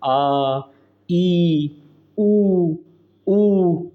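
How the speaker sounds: आ, ई, उ, ऊ each held long; sustained, drawn-out आ, ई, उ, ऊ; long vowels. Shown as a demonstration. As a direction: stretch every vowel out, आ, ई, उ, ऊ, with pauses between. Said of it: "जिनमें बोलने में टाइम लगता है".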